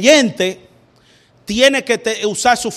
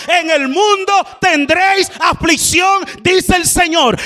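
higher and shouted, "mundo" rising; preaching at full force; 7 semitones higher than usual. Acted. Preacher repeating the Lord's words, shouting it out. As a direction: cry the verse out to the congregation.

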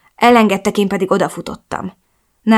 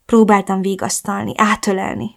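ordinary delivery, nothing marked

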